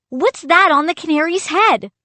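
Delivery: high, loud pleading question; desperate boy. A narrator's reading. Boy argues with the captain over the bird, urgent.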